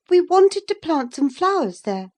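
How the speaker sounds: put-on high, loud defiant girl; young Catherine defends Hareton, crying out high and loud. A narrator's reading. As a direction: cry it out in her defence. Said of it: "she cried".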